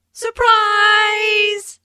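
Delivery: loud, high, long-held cheer; excited party shout. Acted. Guests greeting aunt at her party, excited shout. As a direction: call it out loud and drawn out.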